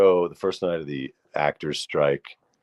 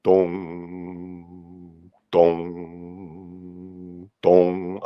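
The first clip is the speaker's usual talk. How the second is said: long drawn-out dongs like a church bell; playful mock bell striking noon; imitating bell tolls; much slower than usual. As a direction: toll each dong slowly like a bell. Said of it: "twelve dongs".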